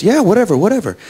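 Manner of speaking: high, careless brush-off; imitated impatient colleague; dismissive agreement.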